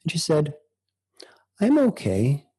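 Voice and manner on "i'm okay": imitating a not-quite-okay answer, pitched up; more than a mere pleasantry, slightly higher; hesitant okay. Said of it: "a little bit more than that".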